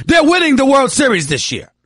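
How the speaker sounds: loud, high proud boast; excited shout. Real, not acted. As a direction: belt it out like a fan. Repeats Yankees boast, second shouted.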